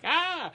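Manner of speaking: high sharp mock scream. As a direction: yelp it high like a startled man. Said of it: "and he's like".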